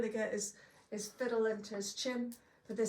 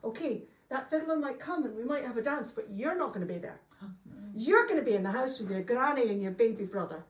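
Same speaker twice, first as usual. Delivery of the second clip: stern scolding, louder and fast; a touch of an angry mum laying down the law; angry mother; 7 dB louder than usual. Acted. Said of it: "she was so angry".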